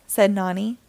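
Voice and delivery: plain reading voice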